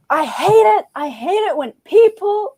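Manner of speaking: imitated high-pitched exasperation; worked-up, high mock complaint; exasperated rant. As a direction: mimic a fed-up lecturer venting. Retells a speaker's exasperated rant.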